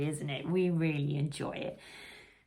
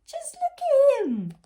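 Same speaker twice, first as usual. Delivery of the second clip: much higher and louder, mocking; scoffing bees; 9 dB louder than usual. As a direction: squeak it out in disbelief.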